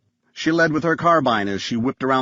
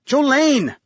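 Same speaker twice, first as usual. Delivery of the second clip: high, urgent shout of a name; yell; 12 semitones higher, 4 dB louder than usual. A narrator's reading. Graham calls Jolene as sirens near, urgent yell. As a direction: call out sharply in alarm. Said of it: "Graham yelled".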